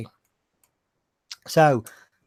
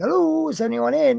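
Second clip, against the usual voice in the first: a high, raised call into the shop; 11 semitones higher, 4 dB louder than usual. Retold or imitated. Walking into the butcher's, calling out high and loud for anyone. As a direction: sing out a high hello to find the butcher.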